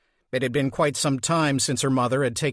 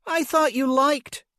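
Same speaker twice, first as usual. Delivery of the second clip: teasing mother; 11 semitones higher than usual. Acted.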